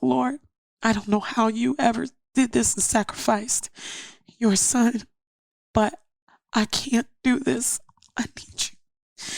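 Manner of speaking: high, pleading prayer that sinks to a whisper; tearful prayer. Retold or imitated. Mother at the altar begging God for her baby, tearful plea. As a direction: beg the Lord, voice breaking. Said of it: "I was just on that altar crying out".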